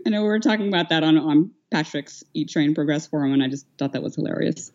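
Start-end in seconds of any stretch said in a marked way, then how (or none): none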